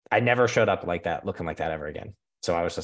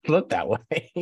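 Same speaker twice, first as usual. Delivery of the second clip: higher, slow, laughing echo of looked that way; laughing repeat; 8 semitones higher, slower than usual. Real, not acted. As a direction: laugh through looked that way, drawn out. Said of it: "not because I looked that way".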